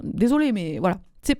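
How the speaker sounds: ordinary delivery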